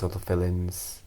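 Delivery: ordinary delivery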